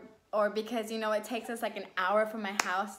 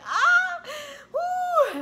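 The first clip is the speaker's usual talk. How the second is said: high, loud imitated cry of pain; reenacted labor wail, ah into woo; 8 dB louder than usual. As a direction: yelp high, then a long high whoop. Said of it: "It was terrible".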